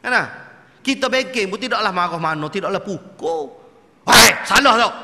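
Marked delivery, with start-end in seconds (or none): 4.1-4.9 much louder sharp rebuke; mock scold. Quran teacher correcting a student, loud mock rebuke. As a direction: snap out the correction. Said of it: "teacher correcting".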